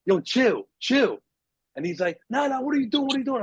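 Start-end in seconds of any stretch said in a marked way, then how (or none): 0.1-1.2 calming him down. Retold or imitated. Calming the armed kid down. 2.3-3.4 high, jumpy repeated demand; nervous kid snapping questions; suspicious thief. Retold or imitated. Thief demanding answers from the boy on the floor, high and jumpy.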